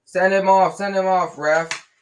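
loud, high angry yell. A narrator's reading. Dean yelling from the stands at the ref, loud angry yell. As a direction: bellow it at the referee. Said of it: "Dean Thomas was yelling".

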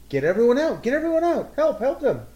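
high, loud mock-panic yells; hammed-up urgent cries for help; alarmed shouting. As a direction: shout a fire alarm to clear everyone out. Player acts out raising alarm.

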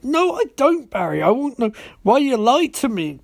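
high-pitched, hammed-up denial; mock indignant retort. Iris demands why Barry lied, mock indignation.

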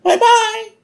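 very high, loud cartoon-wife farewell; Marge. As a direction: squeal a mock goodbye. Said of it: "Marge is like".

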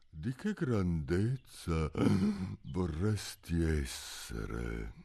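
very slow, drawn-out question; slow caterpillar. Acted. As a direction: ask it lazily. Il bruco domanda. (bruco lentissimo)

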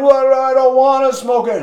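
high, wailing mock sob; mock crying. Smoker refusing with a mouthful of cigarettes, mock crying. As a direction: whine it like a hammed-up crybaby. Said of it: "he's, like, crying".

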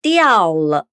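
falling tone; Mandarin diào le. Shown as a demonstration. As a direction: diao le as diào le, falling then light. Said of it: "caiu, ficou".

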